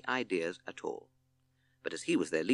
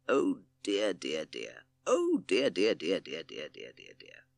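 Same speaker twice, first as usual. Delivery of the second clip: dismayed; 3 semitones lower, slower than usual. A narrator's reading. Owl hearing Bat's bad signal, dismayed. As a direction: repeat it in dismay, fading right down.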